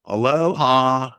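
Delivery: put-on drawn-out, high, loud country-accent greeting. A narrator's reading. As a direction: stretch it slowly in a silly country accent. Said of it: "said it in a country accent".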